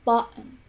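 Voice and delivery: "button" with the T swallowed as a catch in the throat; glottal T. Shown as a demonstration. Example of American English, "button" said with a glottal stop for the T. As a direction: stop the throat mid-word in "button", no released T. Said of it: "pronounced with the glottal".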